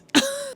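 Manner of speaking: quick high-pitched laugh; small burst of amused laughter; short giggle. Real, not acted. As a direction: let out a brief giggle. Laughs at own joke "Yet.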